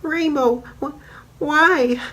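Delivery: very high, stammering hurt voice; wounded Pinky asking Rainbow why, high and stammering; whimpering Pinky. Retold or imitated. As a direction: stutter it out in shock and pain. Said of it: "Pinky is just like".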